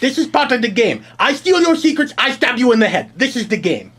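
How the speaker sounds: put-on loud, high-pitched caricature of a Chinese accent; mock Chinese accent. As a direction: shout it in a hammed-up Chinese accent. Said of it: "talk like a Chinaman".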